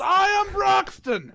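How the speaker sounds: loud, slow, booming self-introduction; joyful shout. Acted. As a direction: bellow your name with a bear hug. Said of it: "He goes".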